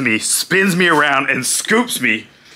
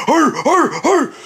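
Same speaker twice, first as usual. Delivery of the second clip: high running grunts; imitated grunts; 10 semitones higher than usual. Wrestler running from the turnbuckle with his load, imitated grunting. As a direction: grunt with each stride, imitating a charging wrestler.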